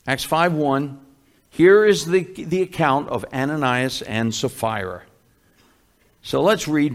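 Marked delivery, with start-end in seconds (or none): none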